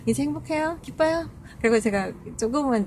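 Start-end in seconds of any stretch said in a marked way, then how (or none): 0.1-1.3 higher, mocking sing-song question; sarcastic. Speaker taunting the grandmother after giving up the seat, mocking. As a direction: needle her with a teasing high lilt. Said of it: "그래서".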